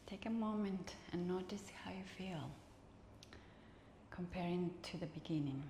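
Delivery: soft whispered meditation voice; guided relaxation. Acted. Teacher guides students in final rest, whispered. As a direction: ease them into stillness.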